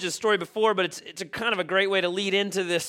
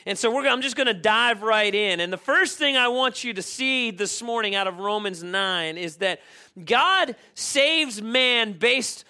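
raised, emphatic preaching voice, higher and driving; fervent preaching; 5 semitones higher, 3 dB louder than usual. Acted. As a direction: preach it to the congregation with full conviction. Preaching at full force.

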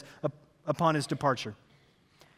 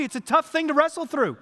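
preaching; 8 semitones higher, 5 dB louder than usual. Acted. Pastor preaching to the congregation, loud and high. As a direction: drive it home to the church.

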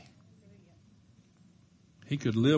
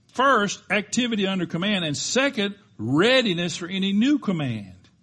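higher, louder list of commands; loud preached recap; 8 semitones higher, 7 dB louder than usual. Acted. Preacher summing up the quote for the church, firm and loud. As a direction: drive home each point.

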